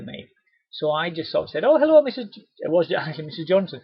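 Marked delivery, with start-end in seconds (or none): 1.6-2.4 high, loud cheery hello; cheery greeting. Retold or imitated. Rep greeting a customer on her doorstep, cheery. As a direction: greet her warmly and brightly.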